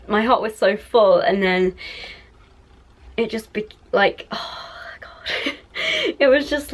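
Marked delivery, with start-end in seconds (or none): 3.9-6.7 slow, choked whisper breaking into a laugh; tearful whisper. Real, not acted. Describing the cat being taken, in a tearful whisper. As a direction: barely get the words out through tears. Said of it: "I need to stop crying".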